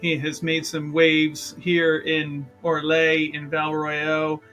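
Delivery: usual speaking voice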